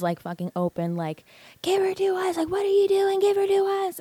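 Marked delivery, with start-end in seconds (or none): 1.6-4.0 high-pitched imitation of a distant yell; stepdad calling up. Stepdad at the bottom calling up to the balcony, mock yell. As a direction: call up in a high, strained mock shout. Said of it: "looking up with his arms".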